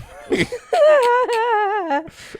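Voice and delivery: long, high, loud laugh; burst of laughter. Real, not acted. Husband backing out of reading old emails, laughing hard. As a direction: crack up helplessly.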